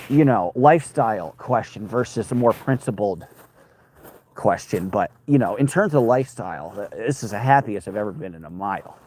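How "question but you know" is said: normal talking voice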